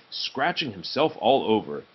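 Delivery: ordinary delivery, nothing marked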